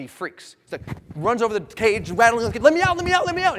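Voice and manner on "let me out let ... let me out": high, loud panicked pleading; acted panic, yelling to be let out; freaking out. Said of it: "he's freaking out".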